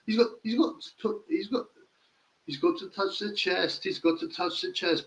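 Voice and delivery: high, soft parody of a boxer's corner line; mocking Barry Jones. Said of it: "How many times will Barry Jones say".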